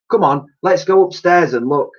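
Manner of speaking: put-on girl urging the others upstairs, high and eager; girl's voice. A narrator's reading. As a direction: urge her friend along. Girl character voice.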